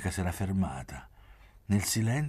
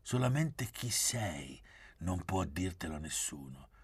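usual speaking voice

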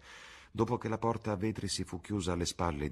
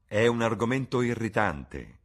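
louder, higher interjection; interrupting; 4 semitones higher, 7 dB louder than usual. A narrator's reading. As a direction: cut in emphatically. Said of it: "interruppe Lord Henry". (interruzione decisa, a voce più alta)

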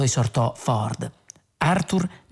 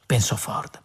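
ordinary delivery

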